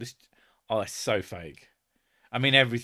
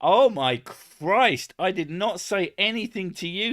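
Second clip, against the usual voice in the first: exasperated; 7 semitones higher, 5 dB louder than usual. Real, not acted. Man telling off a device that butted in, exasperated. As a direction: scold the gadget in disbelief.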